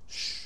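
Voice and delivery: soft drawn-out shush; quiet "shh" to silence the room; hushing shh. Real, not acted. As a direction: hush the audience quietly. Hushing those who heard it earlier.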